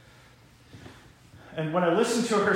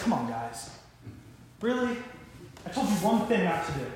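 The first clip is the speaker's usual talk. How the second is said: mildly weary disbelief; exasperated sigh; 6 dB quieter than usual. Acted. God to the people who broke the one rule, mildly exasperated. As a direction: let down, with a sighing letdown on the opening words. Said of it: "looks at them and sighs and says".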